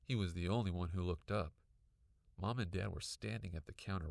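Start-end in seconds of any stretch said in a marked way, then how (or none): none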